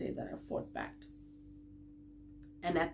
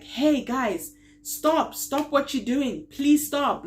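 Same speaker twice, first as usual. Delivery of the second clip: raised, higher, urgent yell; retold screaming; 8 semitones higher, 7 dB louder, slower than usual. Woman yelling at muggers to leave her friend, raised urgent shout. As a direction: yell at them to stop. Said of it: "I start screaming at these guys".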